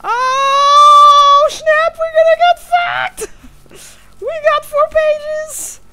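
high, drawn-out panicked squeal; really loud. Real, not acted. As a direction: shriek it in alarmed excitement. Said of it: "I'm really loud".

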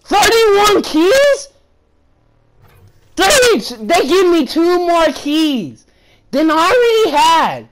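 high, incredulous outburst; indignant disbelief. Real, not acted. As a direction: exclaim in outrage at the reward. Disbelief at a stingy key reward.

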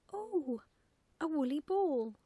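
put-on high, loud little girl's exclamation; oh, swooping high with excitement; excited cry. A narrator's reading. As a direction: cry out in delighted discovery. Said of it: "cried the little girl".

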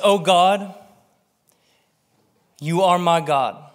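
slow, measured reading of the psalm; scripture reading. Acted. Preacher reading Psalm sixty-three aloud to the congregation, slow and earnest. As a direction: read it slowly and earnestly.

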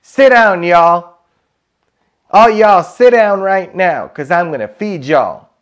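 loud, high hammed-up Southern holler; put-on Southern accent, bossy and loud. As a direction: call the family to eat in Southern twang. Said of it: "that's my southern accent for you all".

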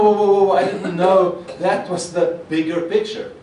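usual speaking voice

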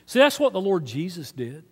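ordinary talk, nothing marked

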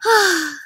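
heavy theatrical sigh. Acted. Teacher voicing the lonely dog, a long sad sigh. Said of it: "she sighs a sigh".

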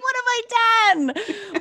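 high-pitched playful wail of regret; hammed-up, high "oh no" voice; mock panic. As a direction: squeal it in comic dismay. Mock regret at her offer.

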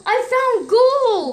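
high, slow, loud cheer; excited. Real, not acted. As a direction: call out the find with joy. Excited find.

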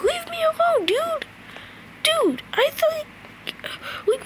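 high, frantic panicked cries; being chased. Real, not acted. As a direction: flee in shrieking panic. Being chased by Balloon Boy.

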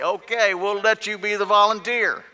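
high, loud acted reply; loud mock agreement. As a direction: call it out as the soldiers eagerly handing off the job. Soldiers hand shepherd boy the job.

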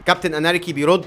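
ordinary delivery

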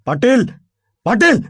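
sudden loud, high-pitched call; अचानक ओरडणे. A narrator's reading. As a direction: shout the name out sharply. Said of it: "अभि अचानक ओरडला". (अचानक मोठ्याने हाक मारत)

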